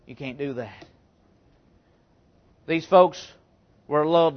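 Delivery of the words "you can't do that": ordinary talk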